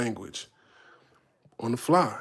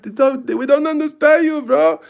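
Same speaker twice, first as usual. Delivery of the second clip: much higher and louder, calling out from the crowd; a fan yelling up to the stage, reassuring; African fan in the crowd; 11 semitones higher, 8 dB louder than usual. Retold or imitated. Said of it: "He's like".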